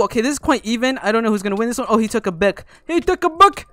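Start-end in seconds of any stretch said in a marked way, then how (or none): 2.9-3.7 loud, high-pitched cry of alarm; alarmed exclamation. Real, not acted. Gamer reacting to opponent taking a book, alarmed exclamation. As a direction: call it out again, much higher and louder. Alarmed repeat during a fight.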